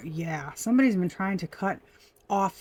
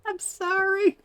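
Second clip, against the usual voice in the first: much higher, giggling sorry; laughing apology; 12 semitones higher, 4 dB louder, slower than usual. Real, not acted. Reader apologizing to viewers, still giggling.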